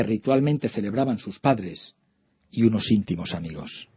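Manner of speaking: soft, measured novel reading; story narration. A narrator's reading. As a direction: read it like a quiet story. Reads novel excerpt aloud.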